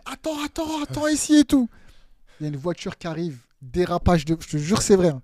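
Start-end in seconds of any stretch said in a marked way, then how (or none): 0.1-1.7 higher, eager "wait here"; excited. Retold or imitated. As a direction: hold him there, urgently. Coach urging him to wait.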